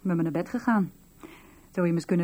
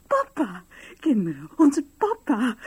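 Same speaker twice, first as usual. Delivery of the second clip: crying with joy; 6 semitones higher, 3 dB louder than usual. Acted. Wife tells children their father is home, crying with joy. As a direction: sob the news to the children. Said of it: "huil toch niet".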